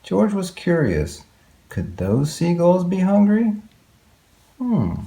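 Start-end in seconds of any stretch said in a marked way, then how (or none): none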